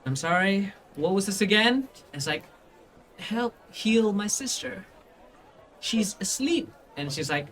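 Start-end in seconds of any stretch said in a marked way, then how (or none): 0.0-1.9 guard. Acted. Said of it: "the guard just goes like". 3.2-6.7 put-on high, slow little-kid voice; small child. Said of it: "the small child just goes like".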